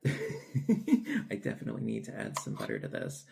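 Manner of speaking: chuckling through the words; laughing. Real, not acted. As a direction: laugh as you say it. Laughing while admiring the bread.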